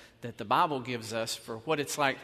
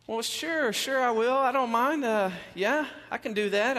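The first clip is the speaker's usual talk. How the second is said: high, eager yes, sure I will; eager agreeing; 9 semitones higher, 4 dB louder than usual. Retold or imitated. Pastor eagerly agreeing to the church lady, high and bright.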